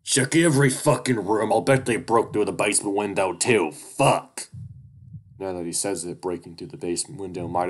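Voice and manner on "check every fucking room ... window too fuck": high, loud barked orders; shouting weasel. Acted. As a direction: yell orders furiously. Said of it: "Duke continues to shout".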